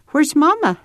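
put-on high little-girl question; child asking. A narrator's reading. Ada asks Papa about Mama, high child's question. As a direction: ask in a small child's high voice.

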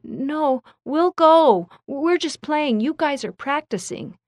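put-on high-pitched young boy voice; upset little brother. A narrator's reading. Upset little brother Ryan offers to go.

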